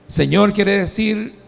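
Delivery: ordinary talk, nothing marked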